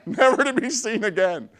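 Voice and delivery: high, very loud, slow punched-out storytelling; shouted climax. Real, not acted. Speaker telling how the man fled the grave, shouted climax. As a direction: belt out each phrase for comic payoff.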